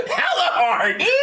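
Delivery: high, drawn-out words through real laughter; helpless high-pitched laughing delivery. Real, not acted. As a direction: crack up while saying it. Cracking up at their blunder.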